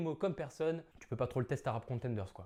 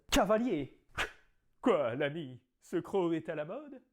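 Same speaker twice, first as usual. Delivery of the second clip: theatrical Cyrano declaiming; grand stage verse delivery; hammy stage actor; 3 semitones higher than usual. Acted. Performs Cyrano's nose tirade.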